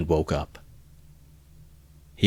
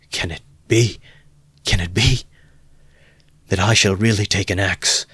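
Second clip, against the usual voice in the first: horrified; 2 semitones higher, 4 dB louder than usual. A narrator's reading. Horrified self-questioning.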